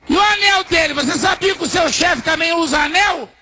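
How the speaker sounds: loud, high rhetorical questions; heated challenge. Retold or imitated. Pastor challenging the waitress about her church leader's gold, heated challenge.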